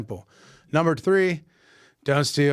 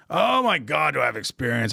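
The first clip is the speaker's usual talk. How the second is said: eager boasting. Retold or imitated. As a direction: imitate an applicant gushing about experience. Acting the eager job candidate.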